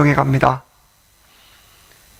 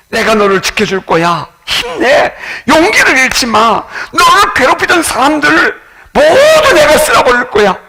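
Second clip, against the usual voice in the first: high, loud, rising protective promise; rally them, promising to sweep enemies away; God's fierce reassurance; 13 semitones higher, 7 dB louder than usual. Retold or imitated. God reassures loudly. (하나님이 높고 크게 지켜주겠다고 약속하다)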